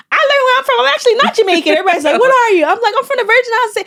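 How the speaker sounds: put-on bubbling kid announcement; excited kid. Child tells classmates her new origin, high and eager. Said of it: "I remember saying".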